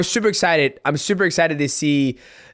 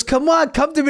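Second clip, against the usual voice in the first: mock taunt; 12 semitones higher than usual. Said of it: "he goes".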